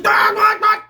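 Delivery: high, loud startled cry; high frightened what what; panicked yelp. Retold or imitated. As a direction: yelp in alarm at the brakes. Panic at brake lights.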